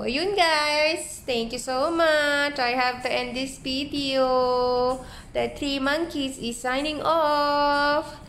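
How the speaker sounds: drawn-out YouTube sign-off; vlog sign-off. Real, not acted. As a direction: stretch out each phrase to say goodbye to viewers.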